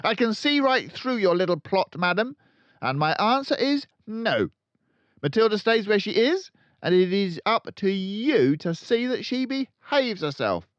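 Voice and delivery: loud, high barking headmistress; booming tyrant headmistress; barking Trunchbull. A narrator's reading. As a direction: bark it out like a bully. Trunchbull rants.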